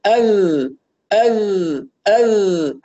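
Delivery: ال clear and forward with no nasality; correct model. Shown as a demonstration. Teacher modelling correct ال for student. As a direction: model ال cleanly, front of the mouth. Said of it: "هكذا".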